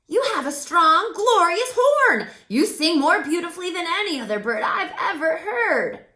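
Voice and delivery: loud, high cheerful crow; crow Woof voice. A narrator's reading. Crow introduces himself to Jazz, loud and cheerful. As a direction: play the chatty friendly crow, loud and bright.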